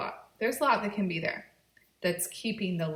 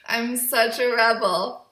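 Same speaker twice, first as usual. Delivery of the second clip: playful laughing; 4 semitones higher, 10 dB louder, slower than usual. Real, not acted. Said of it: "I'm doing a YouTube video with gum in my mouth".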